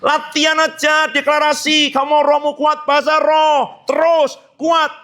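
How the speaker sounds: rising, insistent call to practise; fervent preaching. Acted. Preacher urging the church to practise, in a fervent, rising voice. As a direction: drive it home like a revival preacher. Preacher exhorting at full force.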